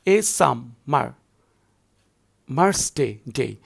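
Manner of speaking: "a summer" in slow beats, then "day" repeated alone as the stressed one; weight the second beat of each foot, echoing "day"; deliberate foot-by-foot demo ending on a repeated "day"; stress on second syllable. Shown as a demonstration. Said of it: "every second syllable will be given stressed".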